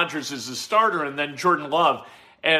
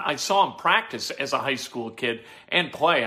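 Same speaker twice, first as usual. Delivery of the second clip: usual speaking voice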